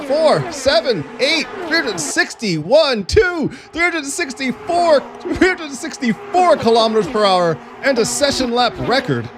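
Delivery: high-pitched, climbing count of the speed; mounting excitement. Real, not acted. Commentator calls out a climbing record speed, mounting excitement. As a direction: build amazement with every number.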